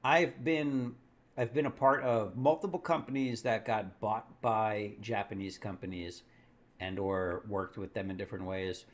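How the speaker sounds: ordinary delivery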